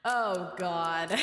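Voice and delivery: usual speaking voice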